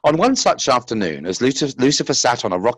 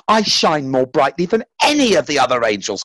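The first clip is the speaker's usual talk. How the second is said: higher, louder proud boast; proud cry; 6 semitones higher, 4 dB louder than usual. A narrator's reading. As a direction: proclaim it, lifted and louder. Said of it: "cried Lucifer".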